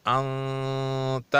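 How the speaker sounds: انت with the nun hidden in the nose, stretched long; nasal ikhfa. Shown as a demonstration. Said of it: "اس کا اخفا ہوگا اور اس کو تھوڑا لمبا بھی کرنا پڑے گا". (انت میں نون کا اخفا، ناک میں لمبی آواز)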